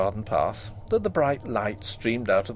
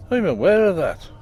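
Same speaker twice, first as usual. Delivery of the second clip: grim; 6 semitones higher, 6 dB louder than usual. A narrator's reading. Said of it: "rather grimly".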